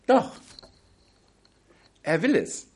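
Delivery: usual speaking voice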